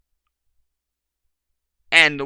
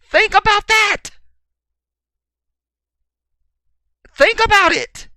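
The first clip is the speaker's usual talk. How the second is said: very high, loud yelling; screamed challenge, pitched way up; full-force shout; 11 dB louder than usual. Real, not acted. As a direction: yell each phrase at the listeners. Preacher yelling at the audience.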